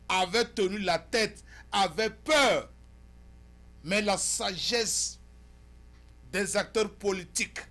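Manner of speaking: loud, high, emphatic oratory; forceful editorial monologue. Acted. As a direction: declaim to the viewers in punched phrases.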